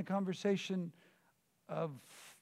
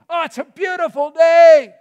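much higher, loud and elated; joyful shout; 10 semitones higher, 24 dB louder than usual. Acted. Stepping out of the house, shouting joy at the day. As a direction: burst out the door shouting with delight. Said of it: "you're going".

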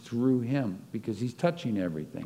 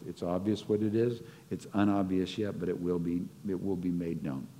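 ordinary talk

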